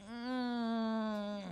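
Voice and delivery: ordinary talk, nothing marked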